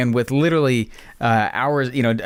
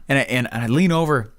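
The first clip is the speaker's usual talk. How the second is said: normal talking voice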